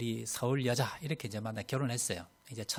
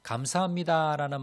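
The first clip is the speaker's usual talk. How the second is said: higher and brighter, said with real gratitude; 감사합니다 said raised and glad; heartfelt thanks; 4 semitones higher than usual. Shown as a demonstration. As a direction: lift 감사합니다 up warmly. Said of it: "마음이 좀 따뜻해집니다".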